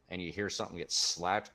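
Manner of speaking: ordinary delivery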